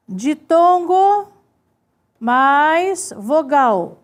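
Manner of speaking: word by word with long pauses; drawn-out words, widely spaced; slow dictation. Shown as a demonstration. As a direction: dictate slowly while writing it out. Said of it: "vou colocando assim".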